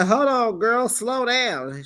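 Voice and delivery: a hammed-up husband telling her to slow down; imitating the husband. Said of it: "He was like".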